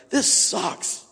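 breathy high groan of misery; groaned complaint. Retold or imitated. Recruit groaning to his buddy mid push-ups, breathy high groan. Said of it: "I'm like".

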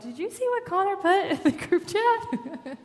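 high, loud and laughing through the question; giddy can't-believe-it glee, laughing as you ask; laughing disbelief. Retold or imitated. To his sister about the brother's text, laughing high and loud.

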